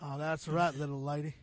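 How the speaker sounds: low, drawling cowboy. Pretend. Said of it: "talking like a cowboy".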